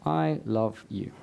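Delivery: measured, low-pitched mantra; gentle affirmation. Shown as a demonstration. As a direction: offer the affirmation slowly and deliberately. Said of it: "the words I'd like you to replace it with".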